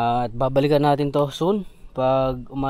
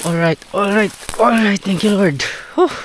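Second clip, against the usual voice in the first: relieved excitement; 8 semitones higher than usual. Real, not acted. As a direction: cheer in relief after the climb. Relieved after climb.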